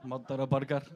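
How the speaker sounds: low, deadpan Scottish "Murder a burger"; Scottish party trick, wearily obliged; Scottish accent. Retold or imitated. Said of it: "ask me to say stuff".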